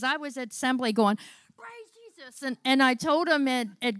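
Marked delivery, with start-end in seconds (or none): 1.6-2.4 very high, soft little squeal of praise; exuberant praise, imitated. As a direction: mimic yourself praising in a tiny high voice. Re-enacting her praise at assembly.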